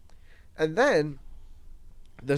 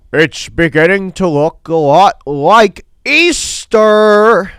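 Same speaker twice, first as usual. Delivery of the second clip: slow, loud, high sing-song announcement; a high schooler strolling in and announcing himself, slow and loud; teen's creepy greeting; 4 semitones higher, 16 dB louder, much slower than usual. Retold or imitated. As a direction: draw it out like a weird entrance line. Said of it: "Literally said it exactly like that".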